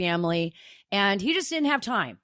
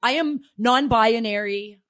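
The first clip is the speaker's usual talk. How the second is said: normal talking voice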